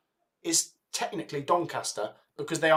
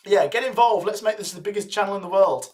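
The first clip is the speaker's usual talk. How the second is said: ordinary delivery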